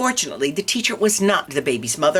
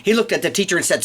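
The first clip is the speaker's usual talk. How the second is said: usual speaking voice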